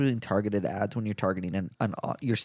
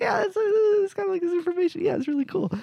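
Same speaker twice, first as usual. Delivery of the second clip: much higher, squeaky wowed voice; impressed friends; faster than usual. Retold or imitated. Friends marvel at [name]'s realtor card in a squeaky voice. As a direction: play a starstruck kid seeing a rare card. Own reply acted, high voice.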